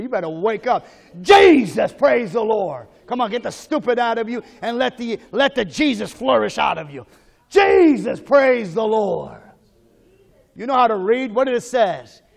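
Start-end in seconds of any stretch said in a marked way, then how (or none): 1.2-2.9 louder, more fervent call of praise; really mean it. Shown as a demonstration. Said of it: "Say it like you really mean it". 7.5-9.4 fervent shout. Shown as a demonstration. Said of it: "Say it like you really mean it".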